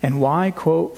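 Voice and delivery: normal talking voice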